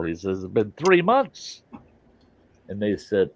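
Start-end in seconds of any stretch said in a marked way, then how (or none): 0.4-1.6 whiny complaint. Retold or imitated. Scientist complains of lonely months.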